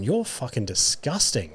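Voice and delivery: slow, quiet disgusted aside; muttered disgust. Retold or imitated. Podcaster retelling his reaction to the nose-blower, quietly disgusted. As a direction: mutter it under your breath, drawn out.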